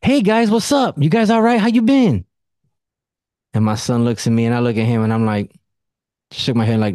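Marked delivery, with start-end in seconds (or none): 0.0-2.2 much higher, sugary cousin greeting; fake-friendly cousin. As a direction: mimic the cousin's overly sweet hello. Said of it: "then she said it again".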